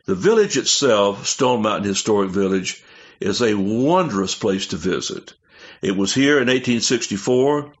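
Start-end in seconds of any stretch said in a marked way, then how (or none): none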